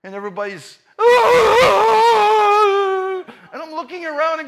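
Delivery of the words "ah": very high, loud crying wail; mock wail. Mourners wail at the bunny's burial, very high and loud. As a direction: let out a long wail. Everybody cries out at funeral.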